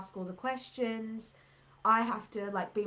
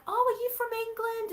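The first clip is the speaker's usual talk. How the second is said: high, quick, excited question; delighted greeting; 13 semitones higher, 4 dB louder, much faster than usual. Retold or imitated. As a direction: imitate a pleasantly surprised stranger in a raised voice. Acts out excited small talk between strangers.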